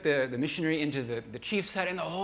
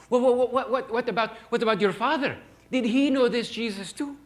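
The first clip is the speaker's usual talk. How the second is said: high, loud stammering plea; urgent old man; 8 semitones higher, 7 dB louder than usual. Retold or imitated. Old shaman questions the missionary, high loud urgent stammer. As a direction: stumble over the words, desperate to know.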